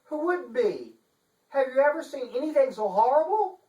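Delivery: high, prim and indignant; a dainty lady playing at outrage; fussy chancellor. Chancellor protesting to the detective, high and prim. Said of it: "Chancellor Phyllis Funderburk was saying".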